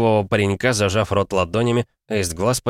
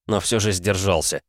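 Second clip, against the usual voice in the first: usual speaking voice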